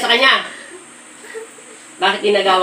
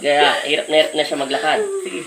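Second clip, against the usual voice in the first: ordinary talk, nothing marked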